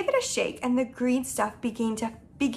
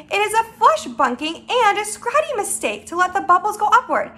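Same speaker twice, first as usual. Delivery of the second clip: mildly heated scolding; raised, worked-up protest; indignant cry; 7 semitones higher, 5 dB louder than usual. Acted. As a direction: rattle off the insult in exasperation. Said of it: "cried the B F G".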